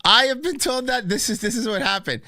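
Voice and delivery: loud, high, mock-offended protest; indignant outburst. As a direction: blow up in playful outrage. Mock outrage at being called fat.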